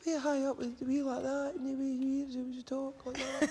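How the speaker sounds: very high-pitched mock Glaswegian; soft Glasgow talk. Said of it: "a lot of it's very soft".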